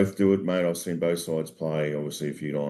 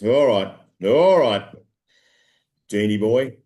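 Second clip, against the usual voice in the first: loud, high all right, all right; mock loud giving in; 5 semitones higher, 8 dB louder, slower than usual. Dave caving to the genie, loud mock surrender. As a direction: bellow it like giving up the argument. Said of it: "Dave goes".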